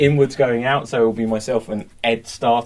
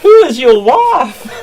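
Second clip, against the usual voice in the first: high nurse voice; 10 semitones higher, 8 dB louder, slower than usual. Acted. Nurse voice in scene.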